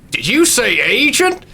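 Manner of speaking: a gruff trucker perking up at a word; suspicious trucker. Acted. GM as the trucker, suspicious of the agent. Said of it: "this trucker's like".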